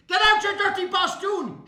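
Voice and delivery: high loud shout of abuse; furious yell. Acted. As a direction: scream him out the door. Shopkeeper yelling insult.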